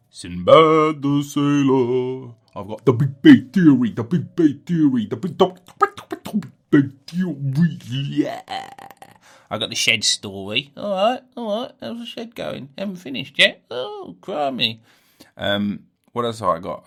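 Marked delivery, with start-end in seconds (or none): 0.1-8.4 loud hammed-up catchphrase voice, drawn out. Podcaster showing off his catchphrases, loud and hammy. 10.8-12.7 high character voice. Pretend. Voiced exchange, other character. 12.8-14.8 character voice. Pretend. Voiced exchange, other character.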